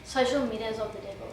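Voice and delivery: understated version. Shown as a demonstration. Woman shows how she really said it, low and understated. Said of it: "how'd you say it?".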